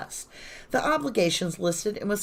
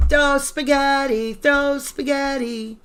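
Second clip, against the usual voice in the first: louder, higher, slow chant; mock rallying cry; 7 semitones higher, 7 dB louder, slower than usual. Real, not acted. Urging viewers on with a mocking loud slow chant. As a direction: egg them on like a coach, tongue in cheek.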